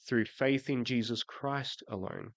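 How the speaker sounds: ordinary delivery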